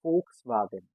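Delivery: Volkswagen said "FOLKS-vah-gen"; Volkswagen, V sounded as f; German pronunciation. Shown as a demonstration. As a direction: start Volkswagen with f, W as v. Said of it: "V sounds as F".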